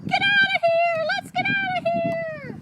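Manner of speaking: high, loud storybook shriek; panicked scream. Acted. Robbers running away from the house, high panicked scream.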